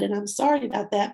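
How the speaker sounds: normal talking voice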